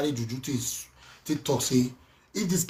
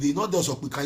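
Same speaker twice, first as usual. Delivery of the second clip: usual speaking voice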